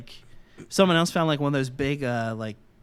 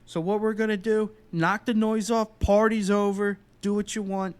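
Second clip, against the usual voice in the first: imitated cop laying down the rules, higher-pitched; police officer; 6 semitones higher, 3 dB louder than usual. Lead officer to underage partygoers, an imitated police announcement. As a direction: announce it like an officer addressing a crowd. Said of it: "lead officer or whatever it was".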